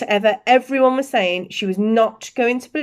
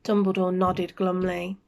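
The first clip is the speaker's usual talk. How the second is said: ordinary delivery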